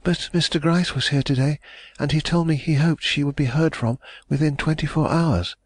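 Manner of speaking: hopeful heiress pressing for news; young lady, anxious. A narrator's reading.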